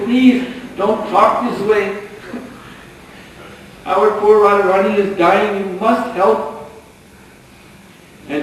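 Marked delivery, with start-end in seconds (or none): 0.0-6.7 desperate pleading. Acted. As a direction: plead as if a friend is dying. Cowherd women begging for Radharani.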